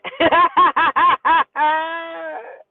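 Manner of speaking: loud helpless laugh; hard laughing. Real, not acted. Storyteller at her own tampon mishap, helpless laughter. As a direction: crack up completely.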